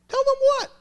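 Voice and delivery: high, loud startled question; alarmed surprise. Retold or imitated. Uncle hearing the niece's plan, startled and loud. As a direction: shoot up in alarm.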